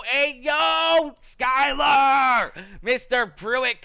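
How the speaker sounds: calling out. Real, not acted. Streamer greeting a viewer who sent stars, loud excited shout. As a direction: yell the name to greet him.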